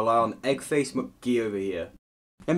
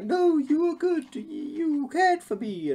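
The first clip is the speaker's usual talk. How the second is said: much higher, slow, simpering girlish put-on; mocking Zelda; slower than usual. Reviewer mocking Zelda forgiving her father, high and slow. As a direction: whimper it like a doting daughter. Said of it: "Zelda's like".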